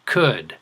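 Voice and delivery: could said again, clear and falling; could with the d just touched; could modelled for the class; model word could. Shown as a demonstration.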